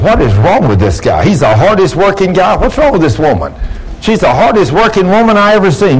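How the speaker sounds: high, animated, baffled coworker voice; incredulous coworkers. Acted. Coworkers marvel, then confront her.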